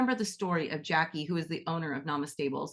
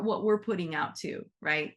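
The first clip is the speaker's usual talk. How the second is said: normal talking voice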